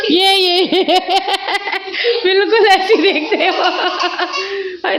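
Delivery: high, giggly laughter breaking the words; laughing. Real, not acted. Mother laughing while showing the baby's look to viewers.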